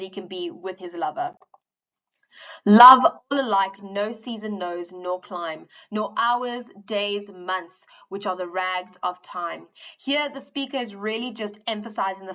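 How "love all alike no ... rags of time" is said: loud, slow opening of the verse; poem reading. Acted. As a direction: declaim it, then settle. Reads stanza aloud.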